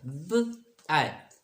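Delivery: ब एथ drawn out very slowly; slow sounding out. Shown as a demonstration. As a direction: stretch each piece of the word. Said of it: "short बन गया".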